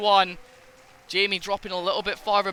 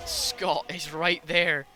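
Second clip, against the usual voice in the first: usual speaking voice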